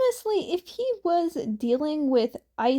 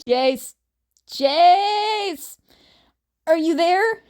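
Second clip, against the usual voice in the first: loud, higher call to a sleeper; Wally calling out to wake Jason, loud; calling to wake him; 4 semitones higher, 6 dB louder, slower than usual. Acted. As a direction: shout his name to wake him up.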